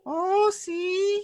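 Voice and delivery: high cartoonish voice for a little hand friend; squeaky puppet voice. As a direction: pipe up like the general's tiny hand puppet. Imitating the general's little friend.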